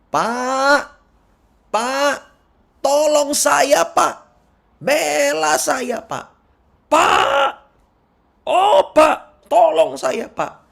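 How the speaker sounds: high, loud wailing plea to the judge, Pak drawn out slowly; desperate pleading cry. Retold or imitated. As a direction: cry out to the judge, begging for help. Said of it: "Dia berseru kepada hakim itu".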